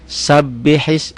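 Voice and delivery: slow Sabbihis with a held double b; doubled b. Shown as a demonstration. Teacher shows the doubled letter, slow and stressed. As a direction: lean on the bb in Sabbihis. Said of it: "cuman banyak double".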